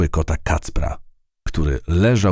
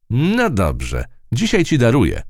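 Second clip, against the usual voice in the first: gracious; 8 semitones higher than usual. Acted. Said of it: "łaskawie oświadczyła".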